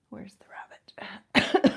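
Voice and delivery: slow unvoiced whisper. Real, not acted. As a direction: whisper it slowly to yourself. Aside searching for a stamp.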